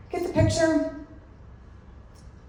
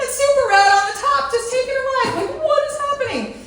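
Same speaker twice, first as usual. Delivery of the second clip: much higher, louder disbelief; incredulous; 5 dB louder than usual. Retold or imitated. Describing the rat riding on top, high disbelief. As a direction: marvel at the absurd sight.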